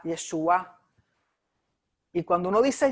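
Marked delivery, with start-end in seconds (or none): 0.0-0.8 "Yeshua" with the stress on the final A, yeshuÁ. Shown as a demonstration. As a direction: lean on the last syllable of "Yeshua". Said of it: "el acento va en la A". ("Yeshua" con el acento en la A final)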